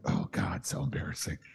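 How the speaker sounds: low, hushed cringing aside; embarrassed whisper. Retold or imitated. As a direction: mutter it under your breath, mortified.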